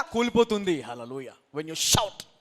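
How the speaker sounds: ordinary talk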